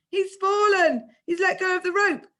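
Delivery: high, loud alarmed shout. A narrator's reading. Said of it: "them shouting up above me".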